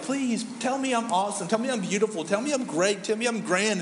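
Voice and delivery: high-pitched mock pleading; begging. Compliment-seeker begging others for praise, mock pleading. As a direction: beg needily for praise, hammed up. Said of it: "always just begging".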